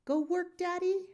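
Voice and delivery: put-on high questioning baby talk; little girl asking her father; toddler voice. A narrator's reading. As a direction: ask like a tiny child. Toddler voice in story.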